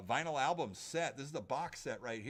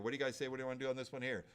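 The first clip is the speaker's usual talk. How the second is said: ordinary delivery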